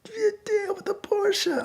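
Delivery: put-on very high, drawn-out drunk woman; drunk caller. Drunk woman announcing herself by phone, high mock whine. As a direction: imitate a drunk woman dragging out her words. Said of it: "she's really drunk".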